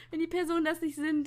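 normal talking voice

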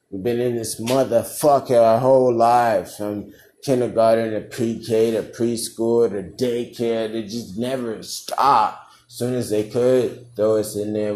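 loud, worked-up comic tirade; mock defiant rant. Senior sounding off about years in school, mock defiant rant. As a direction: sound off loudly, playing it up.

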